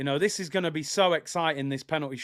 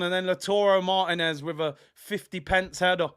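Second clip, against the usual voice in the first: usual speaking voice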